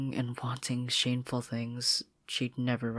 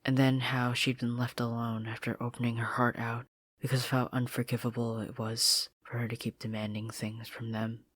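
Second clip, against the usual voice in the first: usual speaking voice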